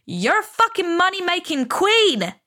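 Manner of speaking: shouted high at the mirror; mock shout. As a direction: yell it at yourself like a hype-up. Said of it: "and I shout at myself".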